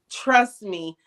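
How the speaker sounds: ordinary talk, nothing marked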